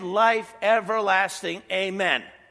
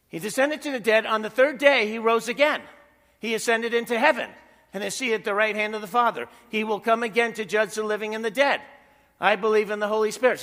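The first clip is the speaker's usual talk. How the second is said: firm, declaiming recital of the creed, slightly louder; creed recitation; 4 dB louder, faster than usual. Acted. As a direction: proclaim each article of faith like a confession. Reciting the Apostles' Creed aloud.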